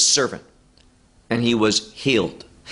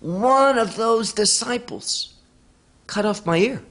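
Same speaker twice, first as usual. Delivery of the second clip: lightly voiced servant; 7 semitones higher than usual. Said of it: "he says".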